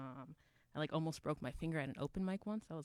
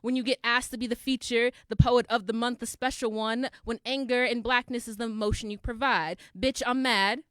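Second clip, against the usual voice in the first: forceful, projected slam delivery; heated, rhythmic stage poetry; angry spoken-word poem; 6 semitones higher, 13 dB louder than usual. Acted. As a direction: drive the grievance out to the audience.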